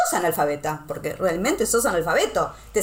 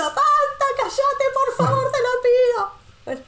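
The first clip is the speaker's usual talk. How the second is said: put-on much higher, exasperated kid voice, slow at the end; embarrassed son; slower than usual. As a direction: beg mom to stop, mortified. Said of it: "mi hijo levantando las manos como diciendo".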